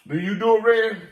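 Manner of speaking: loud questioning of the dog; loud scolding. Real, not acted.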